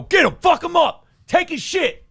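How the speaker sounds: high-pitched mock yelling, like street muggers; thuggish pit bulls. Pit bulls mugging a man round the corner, mock thug yelling. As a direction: imitate dogs as a shouting gang of robbers. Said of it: "they just decided to walk around the corner and go".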